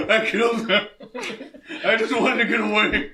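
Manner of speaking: fake-cry the confession, laughing; mock weeping. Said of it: "start weeping and confessing".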